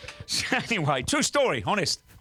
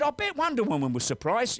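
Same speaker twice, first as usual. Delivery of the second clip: excited quip; 11 semitones higher than usual. Acted. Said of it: "He said".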